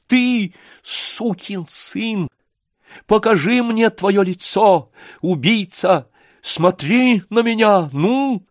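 high, wrathful challenge; commanding shout. Acted. Сашка to Гундосый, demanding his face, wrathful shout. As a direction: cry out slowly and with authority. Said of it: "крикнул Сашка".

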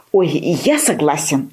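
ordinary delivery, nothing marked

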